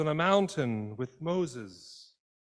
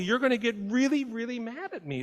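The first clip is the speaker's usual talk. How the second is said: emphatic God; 11 semitones higher, 4 dB louder than usual. Retold or imitated. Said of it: "God speaks".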